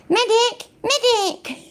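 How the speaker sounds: high mock battlefield yell; hammed-up yell for a medic; man down shout. Mocking a call for a medic.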